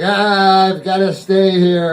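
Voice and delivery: whining spook complaint; whiny ghost. Acted. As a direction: moan it like a self-pitying ghost. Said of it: "And they're whiny".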